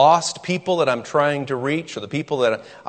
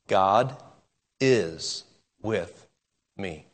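each word set apart with long pauses, trailing softer; slow spaced emphasis; 5 semitones lower, 5 dB quieter, much slower than usual. Shown as a demonstration. Preacher drives home the promise, slow and spaced. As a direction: let every word land alone. Preacher spells out the key phrase.

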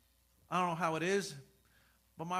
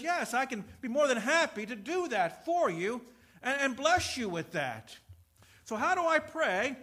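louder, higher, building sermon; full-force preaching; 6 semitones higher, 8 dB louder than usual. Acted. Pastor urging congregation about confident prayer, full force. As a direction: drive the promise home.

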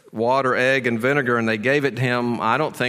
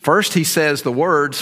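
usual speaking voice

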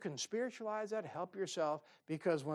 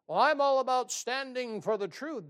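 higher, louder mock know-it-all; self-righteous type; 6 semitones higher, 7 dB louder than usual. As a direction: parody a preachy man.

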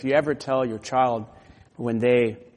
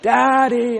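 high call of Daddy; a child calling for dad; crying out; 10 semitones higher, 7 dB louder than usual. Acted. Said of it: "feel free to cry out".

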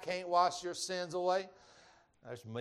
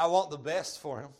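ordinary delivery

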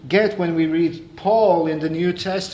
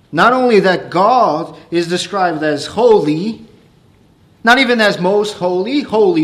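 higher, louder preacher at full force; fervent preaching; 4 semitones higher, 6 dB louder than usual. Acted. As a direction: preach it, rising with awe at God's holiness. Preacher builds to full fervour.

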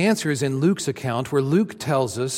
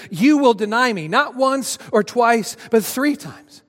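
raised, earnest warning as Jesus, higher and louder; Jesus warning Peter; 7 semitones higher, 4 dB louder than usual. Acted. Jesus solemnly warning Peter he will deny him. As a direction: press the warning home to Peter.

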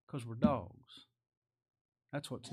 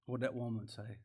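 ordinary talk, nothing marked

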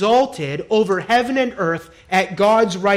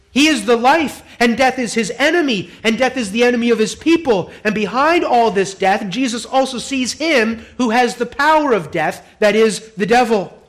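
full-force preaching; 5 semitones higher, 6 dB louder than usual. Acted.

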